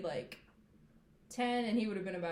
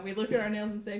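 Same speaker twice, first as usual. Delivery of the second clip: usual speaking voice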